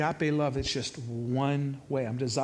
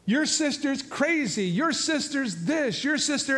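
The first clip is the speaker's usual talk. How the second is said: higher, slow, mock-whining complaint; whiny complaining; 9 semitones higher, slower than usual. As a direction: mimic a sulking boyfriend whining about her. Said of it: "he's crying to me, complaining".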